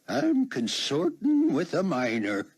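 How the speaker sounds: put-on high, slow old-codger exclamation; husky old man. A narrator's reading. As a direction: drag the words out like a shocked old man. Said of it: "he said, still in character".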